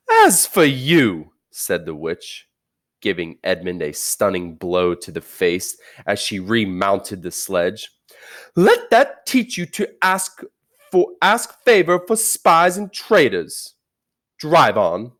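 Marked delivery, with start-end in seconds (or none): 0.1-1.3 high, loud, slow threat; a queen turning on Edmund, high, loud and slow; menacing witch. Acted. 8.5-15.1 high, loud rebuke, then loud order; a queen scolding Edmund, high and loud; cold fury. Acted. Witch striking Edmund.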